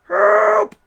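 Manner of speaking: sudden high-pitched cry; high loud yelp. Acted. Sound from the bear's belly.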